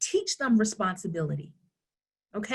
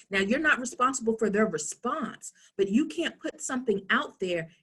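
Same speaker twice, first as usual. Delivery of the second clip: ordinary talk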